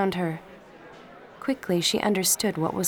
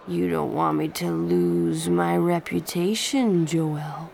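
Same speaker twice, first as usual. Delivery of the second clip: smug boasting; 3 dB louder than usual. Acted. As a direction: gloat about his conquests. Smug drunk boy boasts.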